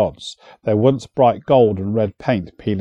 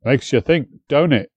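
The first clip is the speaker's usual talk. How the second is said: ordinary delivery, nothing marked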